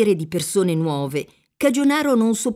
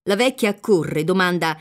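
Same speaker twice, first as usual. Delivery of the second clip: plain reading voice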